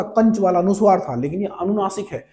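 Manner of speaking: ordinary talk, nothing marked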